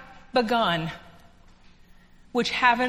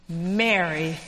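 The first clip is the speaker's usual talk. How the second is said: tender; 8 semitones lower, 3 dB quieter than usual. Acted. Risen Jesus softly names her.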